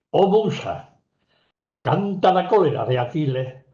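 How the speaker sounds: loud, high rhetorical recitation of verse; first translation declaimed. Shown as a demonstration. Lecturer contrasting two translations of Homer, first one loudly declaimed. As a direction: proclaim the invocation to the muse. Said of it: "No es lo mismo decir".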